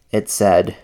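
ordinary delivery, nothing marked

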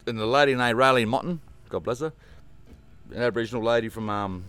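ordinary delivery

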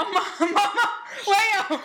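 high, loud laughing cry of mama; laughing too hard to go on; giggling out the word mama. Real, not acted. Laughing at her own story.

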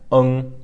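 "ung" ending in a nasal NG; pinyin ENG. Shown as a demonstration. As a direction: push "ung" back into the nose. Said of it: "like that".

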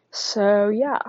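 winded, catching breath. Real, not acted. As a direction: talk while short of breath. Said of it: "I'm out of breath".

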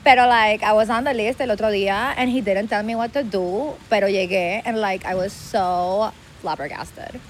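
put-on Miami girl mixing Spanish and English; Miami accent. Shown as a demonstration. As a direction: stretch out the Miami Spanglish complaint. (acento de Miami en spanglish)

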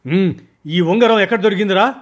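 high, loud, urgent question; anxious eagerness. Acted. Boss eagerly asks his men where the ring came from, high and loud. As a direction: demand it eagerly, unable to wait. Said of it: "నా స్వరంలో ఆతృత స్పష్టంగా".

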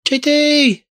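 high calls of J D from the crisp bag; crisps calling his name. Retold or imitated. A bag of crisps calls his name, high and distant. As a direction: call out J D in a high, far voice. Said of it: "shouting my name".

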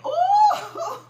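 a falsetto ooh through laughter; laughing high ooh. Real, not acted. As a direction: lift a laughing cry up high. Preacher overcome mid-sermon.

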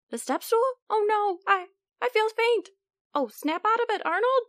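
high, fretful little germ. Acted. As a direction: panic like a tiny germ. Germs panic at the sink.

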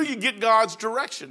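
normal talking voice

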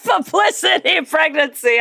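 high, slow, gleeful exclamation; delighted shout. Real, not acted. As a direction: savor each word with glee. Repeating co-host's phrase with delight.

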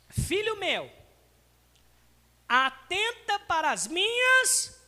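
slow, solemn Bible reading; preacher reading Proverbs aloud; scripture reading. Acted. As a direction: read the verse with weight.

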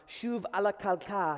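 "Shuv ala kalka" in Hebrew, ala stressed; שוב על הקרקע. Shown as a demonstration. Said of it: "I want you to please take a seat".

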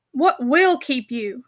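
slow, bitter and cutting; a brother's slow, bitter rebuke; cruel bitterness. A narrator's reading. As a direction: drag it out with cruel scorn. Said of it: "said Tom with cruel bitterness".

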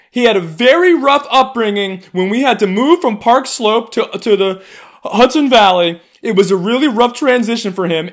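high, loud mocking whiny liberal voice. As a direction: ham up a fussy rich liberal complaining about her dog.

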